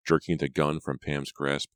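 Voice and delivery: plain reading voice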